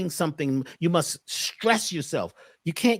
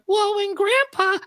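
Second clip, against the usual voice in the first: high, slow put-on voice, much higher; changed silly voice; slower than usual. Shown as a demonstration. Client trying a new voice, high and slow. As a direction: switch to a silly high voice mid-story. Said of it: "They start".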